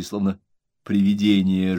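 usual speaking voice

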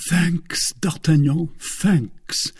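high, overjoyed cry; near-delirious thanks. A narrator's reading. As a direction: cry thanks in a rush of joy. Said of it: "almost in a state of delirium".

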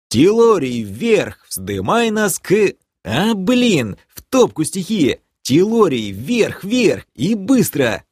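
high, urgent shouted commands; ringing shout. A narrator's reading. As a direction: shout orders up to the flying beast. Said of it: "я звонко выкрикнул". (звонко выкрикивать команды, высоко)

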